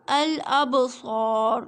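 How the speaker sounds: الابصار drawn out very slowly with a full, heavy ra. Shown as a demonstration. Teacher models الابصار slowly so students hear the heavy ra. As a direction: recite الابصار slowly, making the ra thick. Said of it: "یہ رول ہے را کو موٹا پڑھنے کے".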